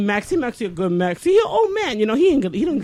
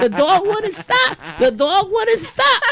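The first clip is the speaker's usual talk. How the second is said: laughing; 9 semitones higher than usual. Real, not acted. Laughing repetition of punchline.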